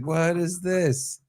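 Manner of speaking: imitating stunned confusion, drawn out; bewildered. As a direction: stretch it out as if baffled. Retold bewilderment at surprise party.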